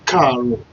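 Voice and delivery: Karo with a rolled tap r and falling tone; Yoruba greeting Karo. Shown as a demonstration. Teacher modelling Karo for learners. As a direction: say Karo slowly, KA then ro, falling. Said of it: "that K is going to sound like".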